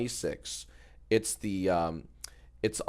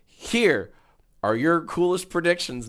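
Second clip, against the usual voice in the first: YouTuber voice; 6 semitones higher than usual. Acted. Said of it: "No, I don't like that".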